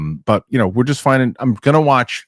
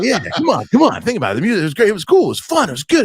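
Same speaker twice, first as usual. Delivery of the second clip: higher, excited mock persuasion; pushy hype; 8 semitones higher, faster than usual. Friend Mark Ellis talking him into liking the film, excited. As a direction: hype the movie up, pushing hard. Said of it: "he's like".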